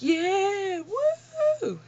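high squealing yeah then woo, leaping way up; overexcited whoop. Retold or imitated. Driving home at night with the windows down, excited whooping. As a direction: let loose like you're alone in the car. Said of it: "I was sitting there like".

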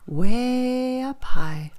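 stretched out high and slow. A narrator's reading. Narrator revealing the hiding place, slow and high. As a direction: draw out the suspense. Storyteller builds suspense.

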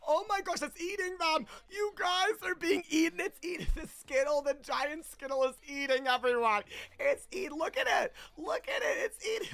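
high-pitched alarmed squealing; shrieky play-horror at a game monster; mock panic. Giant Skittle is eating everyone.

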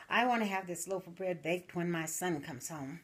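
ordinary talk

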